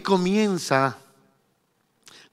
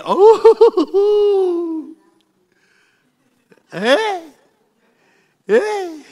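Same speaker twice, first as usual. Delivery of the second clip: mock surprise; 10 semitones higher, 3 dB louder, much slower than usual. Preacher voicing his own surprise.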